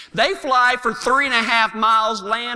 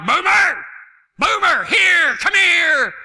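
high, loud shout to a runaway dog; calling the dog; 7 semitones higher, 4 dB louder, slower than usual. Retold or imitated. Owner calls his bird dog off a jackrabbit, high shout. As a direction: holler sharply for the dog to come. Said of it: "Todd was like".

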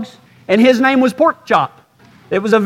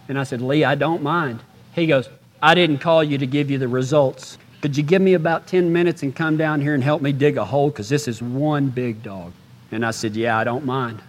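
much softer, subdued grieving voice; hushed grief; 7 semitones lower, 7 dB quieter than usual. Retold or imitated. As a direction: say it quietly as if holding back tears. Grieving phone call retold softly.

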